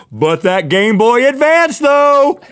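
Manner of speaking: high, loud, slow build to a yell; mock outburst. Boy undercutting his own apology, mock excited yell. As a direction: burst out over the prized gift, hammed-up.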